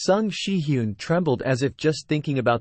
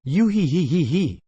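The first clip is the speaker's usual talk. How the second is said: simpering giggle; 3 semitones higher than usual. A narrator's reading. Sung Shi-hyun simpers at the women with a giggle. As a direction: giggle coyly through the hee hees. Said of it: "Sung Shi-hyun simpered".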